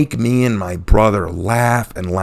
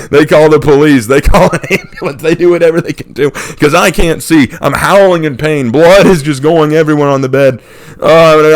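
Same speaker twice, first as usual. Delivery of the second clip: ordinary talk, nothing marked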